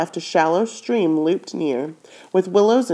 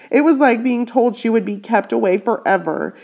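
ordinary delivery

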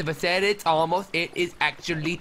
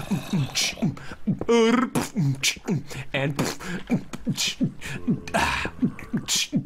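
sharp popping beats with high pf bursts; beatbox walking noise; 4 semitones higher than usual. Real, not acted. Beatboxer adding the walking noise to his beat. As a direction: stomp out a walking beat with the mouth. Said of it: "also gonna do the walking noise".

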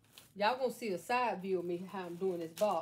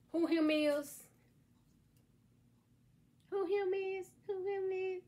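high, sing-song teasing question; cutesy put-on voice; 9 semitones higher, slower than usual. Host teasing today's box character to viewers, high put-on voice. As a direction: pitch it way up and chant it playfully. Imitates noise before revealing the character.

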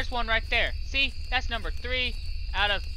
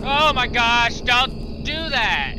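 loud, high, drawn-out plea; alarmed shout; 5 semitones higher, 8 dB louder, much slower than usual. Real, not acted. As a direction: cry out as it comes at you. Mushroom lunges at him.

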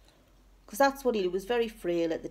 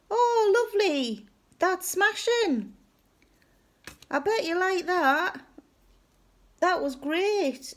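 high, loud, drawn-out gushing approval; pretend enthusiasm; 11 semitones higher, 4 dB louder, slower than usual. Replying to an uncle's painful news without understanding, obliviously enthusiastic. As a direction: gush brightly at news you haven't understood. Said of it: "Pretending to be really enthusiastic about what he's telling me".

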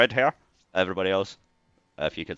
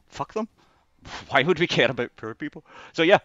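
mock heartless aside, higher, with a laugh; sarcastic callousness; 8 semitones higher, 5 dB louder than usual. Mocking the benefits system, sarcastic callous snob.

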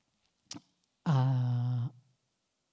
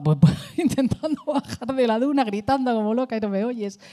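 laughing; 10 semitones higher, 5 dB louder than usual. Real, not acted. Laughing while retelling the scare.

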